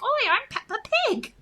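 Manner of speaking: very high cartoon piglet voice; playful high-pitched mock British piglet; Peppa Pig. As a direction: put on a squeaky kids' cartoon pig. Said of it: "how I do Peppa Pig for my kid".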